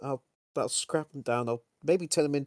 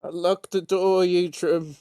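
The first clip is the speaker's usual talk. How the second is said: higher and louder, calling through a door; demanding shout; 6 semitones higher, 7 dB louder than usual. Acted. Locked out, shouting at Eatrim through the door.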